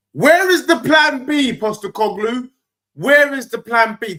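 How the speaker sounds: high, loud taunt at the rival manager; mocking shout. Real, not acted. Taunting the Spurs manager, loud mocking shout. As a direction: yell it up to the rafters, mocking.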